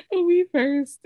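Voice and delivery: cracking up, high and loud; laughing. Real, not acted. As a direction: try to talk through an embarrassed laugh. Cracks up, cringing at memory.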